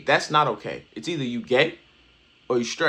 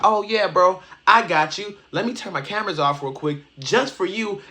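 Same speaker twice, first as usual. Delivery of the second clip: mock Max; 5 semitones higher than usual. Commentator mocks Max agreeing to stop stream, higher mock voice. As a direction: lightly mimic Max, sarcastic and eager. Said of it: "Do you think Max is gonna be like".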